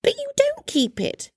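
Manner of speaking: very high, puzzled nephew voice. A narrator's reading. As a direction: squeak out gentle disbelief. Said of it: "repeated Scrooge's nephew".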